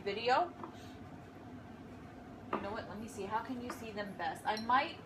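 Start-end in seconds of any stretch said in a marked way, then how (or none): 2.5-4.4 quiet. Real, not acted. Talks quietly while arranging.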